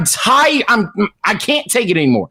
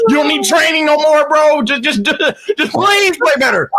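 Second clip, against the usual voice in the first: exasperated pleading; 7 semitones higher than usual. Real, not acted. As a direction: beg him to play better, fed up. Exasperated rant at Russ.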